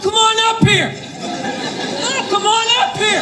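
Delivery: high, laughing dare; mock taunting call. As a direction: goad the player to come up. Fans taunting the player.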